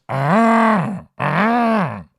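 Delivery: high, loud sung-out "ah, ah"; mock aha. Real, not acted. Host crowing at a commenter's accusation, high loud aha. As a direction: pounce on it like he caught them out.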